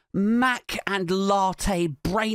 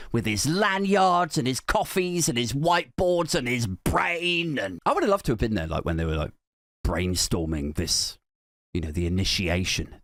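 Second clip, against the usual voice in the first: normal talking voice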